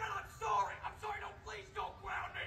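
tiny, very high begging whine; hammed-up, soft high whimper; whimpering plea. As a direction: beg like a kid about to be grounded. Kid begs dad on phone.

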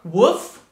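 short light dog bark; a quick light woof like a medium dog. Shown as a demonstration. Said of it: "we're gonna make a light bark".

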